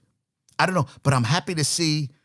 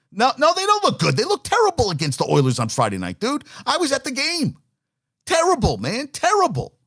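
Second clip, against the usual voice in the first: much higher, louder protest; indignant outburst; 13 semitones higher, 3 dB louder than usual. Real, not acted. Fan who was at the game rebuts praise, indignant. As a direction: protest at high pitch, hammering the word terrible. Host heatedly rejects praise of Rangers.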